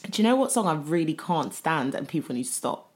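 normal talking voice

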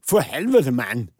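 Danish impression. Shown as a demonstration. Co-host retrying how Danish sounds, loud Danish impression. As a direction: exclaim in exaggerated amateur Danish. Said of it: "hur låter nu danska då".

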